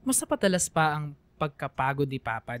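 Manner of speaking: ordinary talk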